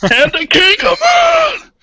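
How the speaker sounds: slow, drawn-out high shout; hammed-up anime shout; dramatic shout. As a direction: bellow the villain's name. Villain name shouted dramatically.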